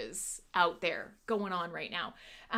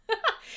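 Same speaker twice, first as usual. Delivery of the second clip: short high giggle; a quick burst of high laughter; laughing; 12 semitones higher than usual. Real, not acted. Host giggling over the viewer's new nickname, laughing.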